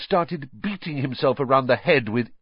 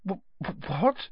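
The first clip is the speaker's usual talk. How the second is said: breathy stutter into a higher what; stammering; 9 semitones higher, 5 dB quieter, slower than usual. Acted. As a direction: stumble over the words in shock. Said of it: "Harry stammered".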